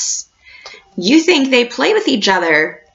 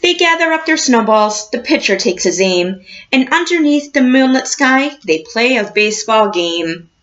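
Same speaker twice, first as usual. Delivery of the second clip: put-on reading to children. A narrator's reading. Teacher reading a picture book to her class, storybook voice.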